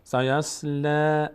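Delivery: سیصلا stretched with a thin آ; slow recitation. Shown as a demonstration. Teacher demonstrates سیصلا slowly for students. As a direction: draw out سیصلا without a full round آ. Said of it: "اما پر نمیشه".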